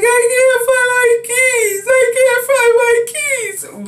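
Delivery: very high, wailing, almost sung plea; hysterical panic. Retold or imitated. Woman answering the police through the door, hysterical high wail. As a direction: cry it out in desperate panic. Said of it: "I'm so frantic".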